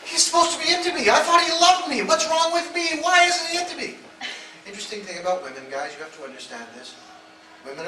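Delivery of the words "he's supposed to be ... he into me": much higher, loud wife's voice; distressed wife. Retold or imitated. Wife wailing about her husband, high and loud. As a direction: whine it out, hurt and baffled. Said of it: "she's going".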